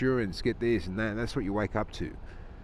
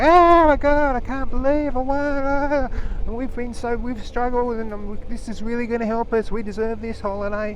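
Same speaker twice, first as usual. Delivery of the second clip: squealing high-pitched ecstatic caller; overexcited prize winner; 13 semitones higher, 8 dB louder than usual. Retold or imitated. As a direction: gush like you just won a holiday. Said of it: "they're gonna get reaction and a soundbite".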